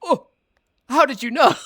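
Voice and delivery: short "oh", pause, then high, loud question; mock being found out, voice leaping up; caught-out reaction. Imitating a friend caught guessing Wordle, caught-out reaction. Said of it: "And you went".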